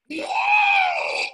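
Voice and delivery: long high-pitched "whoa" shout; drawn-out high holler; psych-up whoop. Acted. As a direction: whoop at full pitch to fire yourself up. Acts out psyching up in cubicle.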